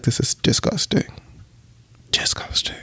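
a fading, half-whispered mock disgust. Vlogger mocking her own crush feelings, fading mock disgust. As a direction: recoil at your own crush, quietly. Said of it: "This is my thought process".

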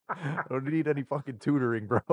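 high, half-laughing outrage; retold losing it. Retelling his rant at a tutoring telemarketer, mock anger. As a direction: replay an irate refusal through amusement. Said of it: "I lost it on him".

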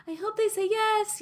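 high hopeful inner voice. Retold or imitated. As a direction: pitch way up, wishing hard. Quoting her hopeful inner thought.

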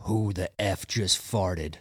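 low, slow and menacing; stern drill instructor. Retold or imitated. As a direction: growl it like the scariest drill sergeant. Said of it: "scariest dude ever, he goes".

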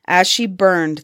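ordinary delivery, nothing marked

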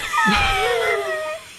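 very high, shrieking laugh; real high-pitched laughter. Real, not acted. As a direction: burst out laughing at the wrong guess. Laughs at Russian guess.

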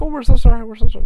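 high, loud imitation of apologising wives; mock apologetic wives. Drunk men's wives apologising to the worker, mock gushing.

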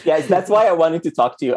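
ordinary talk, nothing marked

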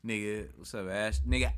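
ordinary talk

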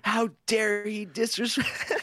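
slow, drawn-out hammed-up indignation; mock scandalized teacher. Friend imagining the teacher looking at him, mock outrage. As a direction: imitate an outraged teacher, rising into laughter. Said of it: "the fucking teacher was probably looking at you like".

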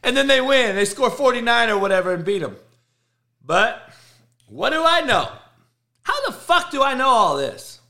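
high, loud triumphant sarcasm; loud, high-pitched mock amazement; gloating. Real, not acted. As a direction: crow it loud and high in fake innocence. Gloating over a correct prediction.

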